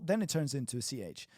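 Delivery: ordinary talk, nothing marked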